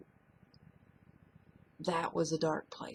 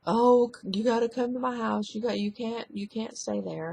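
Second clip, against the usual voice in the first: mock frantic mother, higher and loud at the start; alarmed mom; 5 semitones higher, 6 dB louder than usual. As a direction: urgently pull her daughter home. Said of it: "my mom was like".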